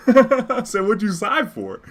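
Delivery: high, loud laughing question. Real, not acted. Teasing his sighing opponent, laughing high and loud. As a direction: laugh it out as you ask.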